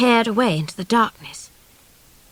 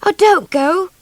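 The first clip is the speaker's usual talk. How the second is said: high pleading cry; begging; 9 semitones higher, 5 dB louder, slower than usual. A narrator's reading. Child begging the drifter not to leave, high plea. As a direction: plead with him to stay. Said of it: "poor baby begged".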